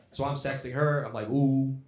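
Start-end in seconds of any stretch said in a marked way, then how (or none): 1.3-1.8 mock flirty. Sexting voice, acting flirty.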